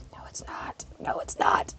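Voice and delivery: whispered, restrained retort; hushed contradiction, barely voiced; muttered denial. Resident privately contradicting a visitor, muttered denial.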